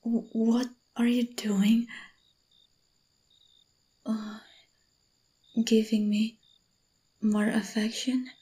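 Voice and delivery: stammered, unvoiced whisper; surprised whisper. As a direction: whisper it, caught off guard.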